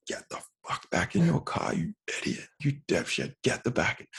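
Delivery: hushed, strained imitation of yelling; whispered mock yell. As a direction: act out a furious shout under your breath. Retold yelling at driver, voice held back.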